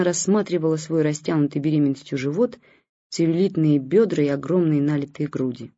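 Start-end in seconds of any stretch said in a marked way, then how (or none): none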